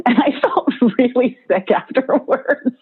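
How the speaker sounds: laughing through the words at her own cookie binge; sheepish laugh while admitting it; laughing confession. Real, not acted. To the audience about eating all the cookies, laughing.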